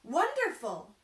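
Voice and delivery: loud, high joyful cry; cried out. Acted. Carpenter thanking his luck, loud high cry. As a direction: shout with delight. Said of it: "cried the carpenter".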